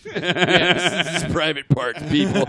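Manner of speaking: high loud laughing call; laughing announcement. Real, not acted. Laughing while telling listeners about the headband. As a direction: laugh while calling it out to people.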